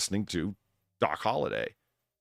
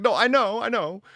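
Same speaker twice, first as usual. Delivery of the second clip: eager agreement; 14 semitones higher, 6 dB louder than usual. Retold or imitated. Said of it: "It's like".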